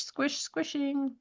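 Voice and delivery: squish, squishing drawn out slow and soft; squishy emphasis. Shown as a demonstration. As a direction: squash the word squish, squishing. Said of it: "not squish".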